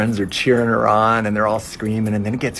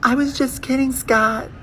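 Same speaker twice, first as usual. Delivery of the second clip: very high, awkward laugh-off; high panicked cover-up; nervous backpedal; 4 dB louder than usual. Retold or imitated. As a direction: squeak out an excuse. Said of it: "She's all like".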